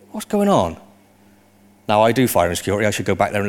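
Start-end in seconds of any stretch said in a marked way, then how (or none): 0.1-0.8 higher-pitched retold bewilderment; bewildered. Speaker retelling the apartment fire alarm, bewildered. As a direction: lift the question high, then let it fall.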